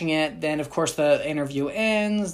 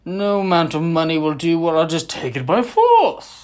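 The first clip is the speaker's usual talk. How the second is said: mock greedy explorer; 2 semitones higher, 6 dB louder than usual. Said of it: "And he'd be like".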